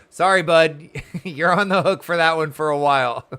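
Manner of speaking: laughing through an apology; teasing laugh, loud. Real, not acted. Streamer laughing as she teases a friend.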